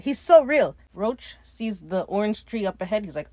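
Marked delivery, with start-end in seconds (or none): 0.0-0.8 swooning mock gush. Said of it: "And Weejon's like".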